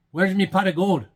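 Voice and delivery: high, loud Irish leprechaun voice. Acted. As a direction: demand it like an angry leprechaun. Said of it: "the line by the leprechaun".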